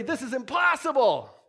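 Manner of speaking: high, exasperated protest; hammed-up wail of complaint upward; mock despairing cry. Believer stuck in a jam complaining to God, high mock despair. People crying out to God in trouble.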